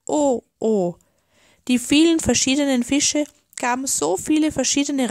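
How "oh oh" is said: plaintive double moan; lightly acted octopus lament; wailing. A narrator's reading. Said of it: "jammerte der Oktopus".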